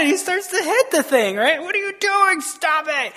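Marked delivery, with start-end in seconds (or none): 1.6-3.1 high-pitched exasperated scolding; mock angry. Balaam scolding the donkey as he hits it, mock anger. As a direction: play an owner fed up with his animal. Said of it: "so Balaam's all mad".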